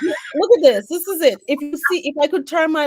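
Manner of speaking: high and loud, eager to show; look, look at this, bursting to show it; excited. Real, not acted. Host eager to show her cluttered desk, excited. Showing sticky notes everywhere.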